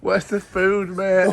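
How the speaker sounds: put-on northern accent. Friend asking about food on camera, hammed-up Northern English accent. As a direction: play a hungry Northern English lad. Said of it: "did most of our footage in a northern accent".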